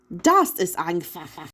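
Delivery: slow, offhand German; German line, lightly voiced. A narrator's reading. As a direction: Gutenberg shrugs off the question, lightly voiced German. Gutenberg speaks German.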